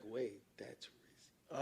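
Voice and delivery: slow whisper turning to amazed laugh; stunned whisper. Retold or imitated. Rapper reliving the shock of hearing the famous feature. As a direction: disbelief at the name.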